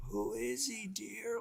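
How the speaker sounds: put-on soft, hushed old man asking; a hint of a frail old man, quiet. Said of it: "an old man later on says".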